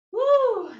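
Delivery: loud, high whoop of exertion; effort whoop. Real, not acted. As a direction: let out a big whoop mid-stretch.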